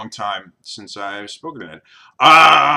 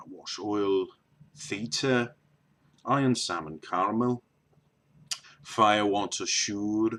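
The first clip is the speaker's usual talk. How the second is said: soft Liverpool accent; Liverpudlian accent; 3 semitones lower, 4 dB quieter than usual. Shown as a demonstration. Vlogger trying an accent not done in a long time, Liverpudlian accent. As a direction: read the words softly as a Liverpudlian. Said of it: "a wee bit of a lower accent".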